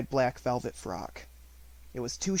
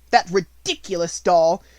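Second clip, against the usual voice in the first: far louder, higher rant; furious shout; 10 semitones higher, 13 dB louder than usual. A narrator's reading. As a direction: rage about the money. Minchin's outburst continues.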